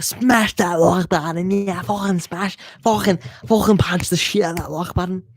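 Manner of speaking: milk it like a hype man begging for likes; hammed-up hype call. Streamer urging viewers to like.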